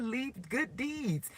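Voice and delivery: slow, soft solemn quote; voicing God. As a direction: hand down the command slowly and softly. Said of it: "He said".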